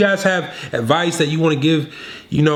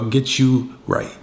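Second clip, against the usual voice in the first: ordinary talk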